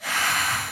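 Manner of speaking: long, soft exhale; deep breath out. Shown as a demonstration. Host leading children into prayer, deep breath out. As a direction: breathe out slowly and audibly. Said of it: "Take a deep breath in and out".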